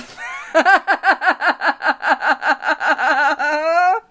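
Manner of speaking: laughing until crying; loud breathless giggle fit; real uncontrollable laugh; high teary laughter. Real, not acted. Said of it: "try not to laugh".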